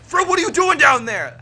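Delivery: put-on a father telling off his child; scolding father. Thompson scolding his son Frill for sneaking aboard. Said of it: "He's like".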